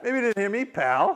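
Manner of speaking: acted-out bristling retort; combative comeback. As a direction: throw "pal" down hard. Repeats his combative comeback.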